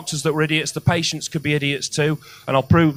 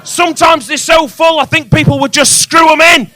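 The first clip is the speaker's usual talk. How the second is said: much higher and louder, yelling in disbelief; incredulous shout; 9 semitones higher, 8 dB louder than usual. Real, not acted. Comic ranting to the crowd about overfull pots, incredulous shout. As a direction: bellow it in disbelief.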